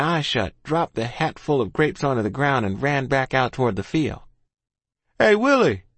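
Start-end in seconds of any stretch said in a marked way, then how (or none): 5.2-5.8 high, loud hail; boy calling out to a friend; shouted. A narrator's reading. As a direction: shout to catch someone's attention. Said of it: "he shouted".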